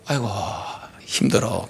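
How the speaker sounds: low, soft mock-weary sigh of words; weary groan. Preacher acting out an idle overeater, weary. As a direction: let it sink low, as if worn out. Acts out overeater too tired to move.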